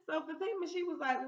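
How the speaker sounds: normal talking voice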